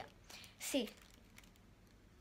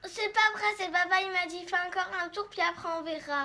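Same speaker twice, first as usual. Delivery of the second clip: loud protest; 5 semitones higher, 8 dB louder than usual. Real, not acted. Daughter protests loudly.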